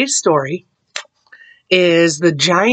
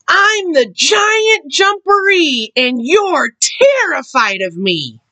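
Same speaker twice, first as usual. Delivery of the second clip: slow, high booming threat; loud giant voice; 10 semitones higher, slower than usual. A narrator's reading. Hidden Giant Jumperee threatening Mama Frog, slow loud voice. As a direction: draw it out high to terrify. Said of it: "came the loud voice".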